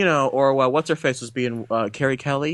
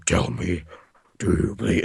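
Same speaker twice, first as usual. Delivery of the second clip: low, robotic superhero voice; Batman; 6 semitones lower, slower than usual. Retold or imitated. Podcaster mimicking the mechanical suit voice he dislikes, robotic. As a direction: drop it down, halting and mechanical. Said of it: "It's all robotic-y and whatnot".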